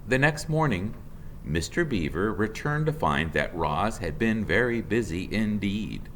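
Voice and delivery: ordinary delivery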